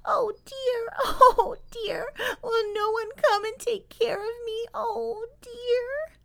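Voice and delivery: put-on very high, thin baby-animal wail; crying away. Lost baby beaver asking someone to take care of her, crying. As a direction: wail pleadingly in a tiny high voice. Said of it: "still crying away like this".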